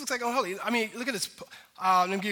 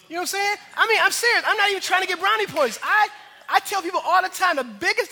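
excited; 9 semitones higher, 7 dB louder than usual. Real, not acted. Pastor gushing about his wife.

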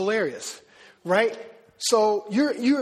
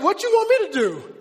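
much higher, louder, eager to please; eager offer; 4 semitones higher, 4 dB louder than usual. Retold or imitated. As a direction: blurt out anything she wants. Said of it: "I'm like".

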